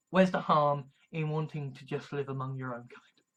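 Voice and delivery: ordinary delivery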